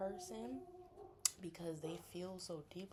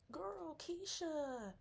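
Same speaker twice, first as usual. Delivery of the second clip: slow, high drawl of the name; drawn out; 11 semitones higher, much slower than usual. Retold or imitated. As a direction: stretch the name out slowly. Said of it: "you like".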